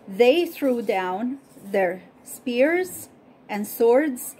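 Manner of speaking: clear, measured Bible story reading; storyteller voice reading scripture; story narration. Acted. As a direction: tell the story to listeners, evenly paced. Reads Bible story aloud.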